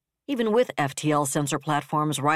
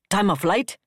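higher-pitched, sharply asked demand; sharp question; 4 semitones higher, 4 dB louder than usual. A narrator's reading. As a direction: ask it sharply, like an admiral wanting an answer. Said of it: "Capriotti asked sharply".

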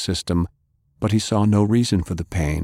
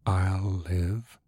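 softer, pained, brushing it off; hurt, quiet; 6 dB quieter than usual. Acted. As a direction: hide the pain, keep it short. Injured man downplays pain.

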